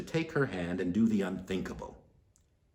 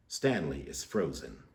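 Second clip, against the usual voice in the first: plain reading voice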